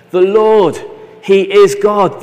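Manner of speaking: loud awed declaration; proclaiming. Acted. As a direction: cry it out from the ground. People fall on their faces.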